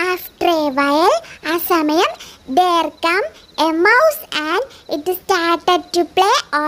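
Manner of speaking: slow, soft storybook voice; bedtime story reading. Acted. Brother reading a bedtime story to his little sister, slow and soft. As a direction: read gently to lull her asleep.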